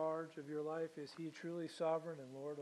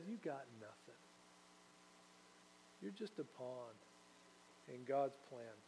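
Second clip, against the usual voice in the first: calm quiet reply; 4 semitones lower, 12 dB quieter than usual. Acted. Jesus calmly answers Pilate.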